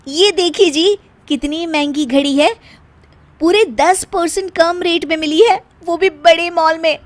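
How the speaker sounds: show-off bahu. Acted. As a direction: show off the bargain proudly. Meena shows off watch to husband. (पति को घड़ी दिखाती इतराती बहू)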